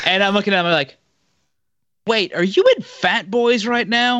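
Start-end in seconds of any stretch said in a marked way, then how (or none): 2.1-4.2 high-pitched mock disbelief; incredulous. Viewer spotting hometown spots in the documentary, incredulous. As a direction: act out startled recognition of a familiar place. Retelling his startled reaction on recognizing places.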